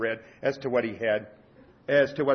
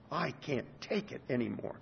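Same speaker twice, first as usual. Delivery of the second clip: much softer, fading repeat; broken near-whisper; 12 dB quieter than usual. Acted. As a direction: let it die away, barely audible. Paul's despair, repeated softer.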